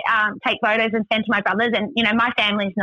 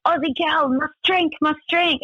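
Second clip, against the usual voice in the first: higher-pitched, insistent mock driver; imitated Russian driver; 6 semitones higher than usual. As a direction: play the driver pushing a drink. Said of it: "And he's like".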